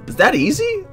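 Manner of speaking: loud, high-pitched disbelieving question; incredulous exclamation. Acted. Narrator suddenly feeling magic energy, loud incredulous question.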